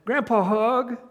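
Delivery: a hint of an eager toddler; small child voice. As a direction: play the little boy reaching up for grandpa. Preacher voices two-year-old boy.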